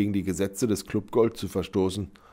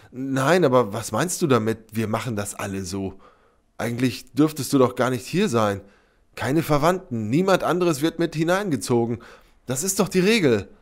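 higher, louder, mounting indignation; agitated protest; 5 semitones higher, 5 dB louder than usual. A narrator's reading. Sepp insists on the club rules to Sybil, agitated. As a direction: protest with rising alarm. Flustered protest after shocking accusation.

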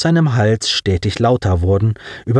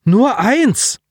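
desperate insistence; 12 semitones higher, 3 dB louder than usual. Acted. Colin keeps protesting.

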